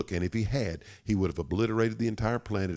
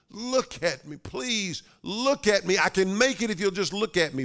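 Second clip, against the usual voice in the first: high-pitched, anguished begging; desperate pleading cry; 11 semitones higher, 3 dB louder than usual. Acted. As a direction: beg desperately, voice pushed way up high. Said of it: "Cried out".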